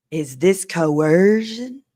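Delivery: very slow, drawn-out prim question; mocking slow quote. Mocking how [name] supposedly spoke, slow and prissy. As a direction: stretch it out sceptically. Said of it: "Who talks like that?".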